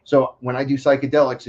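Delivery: ordinary talk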